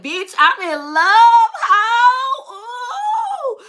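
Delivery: high, drawn-out squeal to a best friend; hammed-up swooning phone confession; lovestruck gushing. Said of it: "on the phone with your best friend".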